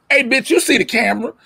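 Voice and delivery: high-pitched indignant comeback; defensive retort. Acted. Acted retort to nosy house guests.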